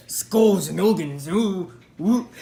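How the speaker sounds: slow, loud, hammed-up spooky chant; mock spooky edgy voice. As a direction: read it like a cheesy horror narrator. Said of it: "this is when it becomes super edgy".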